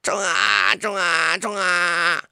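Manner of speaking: small distant voices shouting charge; tiny ants crying charge, far off; faint battle cry. Acted. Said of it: "微弱的叫声".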